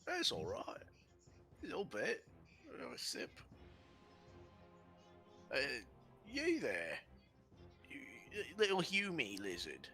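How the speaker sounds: high, dopey giant slurping beer; a dim giant pleased with a drink; two-headed giant. Acted.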